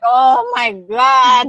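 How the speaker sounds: slow, loud drawn-out oh my God; loud, slow gasp of realization; shocked realization. Retold or imitated. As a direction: stretch it out in loud disbelief. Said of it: "I was like".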